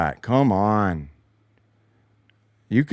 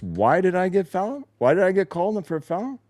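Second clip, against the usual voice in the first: mock confused player; 8 semitones higher than usual. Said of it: "he's curious".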